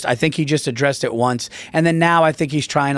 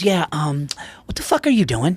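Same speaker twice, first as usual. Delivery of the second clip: imitating Tarantino. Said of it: "Jamie Foxx does an impression of Tarantino".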